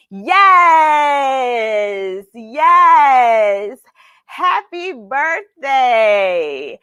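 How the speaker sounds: loud, high celebratory yes; joyful shout of celebration; cheering. Real, not acted. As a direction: cheer them on like a party host. Cheering birthday girls.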